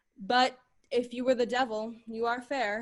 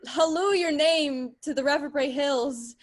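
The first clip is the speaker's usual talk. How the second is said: usual speaking voice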